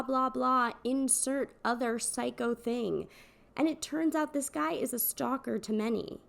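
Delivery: normal talking voice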